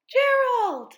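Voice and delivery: loud, high call across to a friend. Acted. Piggy calling out to Gerald, loud and high. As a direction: shout his name to get his attention.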